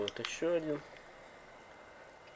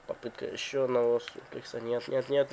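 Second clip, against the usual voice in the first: usual speaking voice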